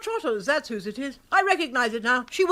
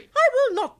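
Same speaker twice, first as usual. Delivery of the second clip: higher, louder flat refusal; indignant refusal; 10 semitones higher, 4 dB louder, slower than usual. Acted. Aunt refusing to let Bertie speak, indignant. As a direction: cut him off sharply.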